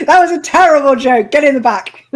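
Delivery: loud, high-pitched jokey outburst; mock scolding. Real, not acted. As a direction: call out the bad joke, loud and high. Jokey reaction to a bad joke.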